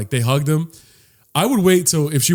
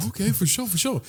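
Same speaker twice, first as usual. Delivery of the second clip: higher, softer imitation of going along; lightly acted eager agreement, pitched up; mock compliance; 8 semitones higher, 6 dB quieter than usual. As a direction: play yourself meekly agreeing. Younger self agreeing to hug advice.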